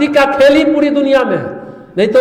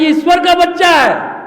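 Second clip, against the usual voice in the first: emphatic shout; 6 semitones higher than usual. Acted. Preacher declares to parents.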